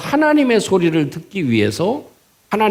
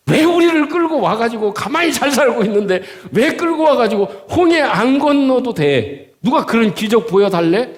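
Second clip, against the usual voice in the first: high-pitched, ranting accusation; angry resentment; 6 semitones higher than usual. Acted. Israelites blaming Moses for leading them out, acted angry rant. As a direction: hammer out the blame, pitch climbing to a peak. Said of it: "엑스엑스를 붙여가면서 하지 않았겠어요?".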